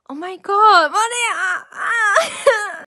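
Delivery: high, loud squeal of alarm; shocked outcry. Real, not acted. Realizing the truth with Maria, a shocked high shriek. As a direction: cry out to a friend, pitch shooting up.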